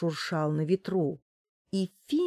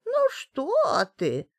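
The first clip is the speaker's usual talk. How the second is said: put-on old woman voice; 8 semitones higher than usual. A narrator's reading. Old woman denies growing.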